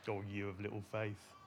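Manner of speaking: low, soft, fond tease; gentle teasing. Shown as a demonstration. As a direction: tease them quietly and affectionately. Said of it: "teasing them?".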